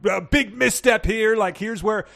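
gleeful armchair detective; excited internet sleuth. Pretend. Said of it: "Reddit sleuths would be like".

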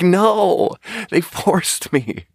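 high, drawn-out comic denial; indignant mock protest. Waiter answering coworker's accusation, mock-indignant.

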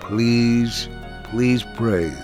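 ordinary delivery

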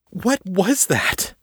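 high, slow shaken question; gasping after retching; shaken girl. A narrator's reading. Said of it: "she said at last".

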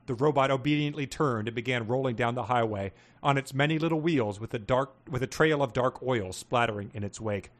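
normal talking voice

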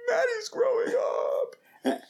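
high, slow mock sob; mock sobbing. Dad retelling the commercial that made him cry, mock sobbing. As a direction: wail it like a heartbroken dad, half joking. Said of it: "And I'm like".

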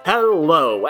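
ordinary delivery

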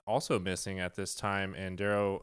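usual speaking voice

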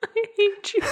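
high, breaking with laughter; laughing. Real, not acted. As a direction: gasp it out through laughter. Laughing repeat.

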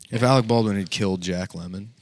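ordinary talk

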